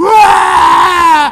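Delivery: put-on 1 child wailing for mama. Child crying out for mother.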